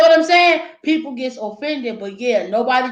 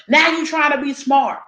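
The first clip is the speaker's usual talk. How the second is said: ordinary talk, nothing marked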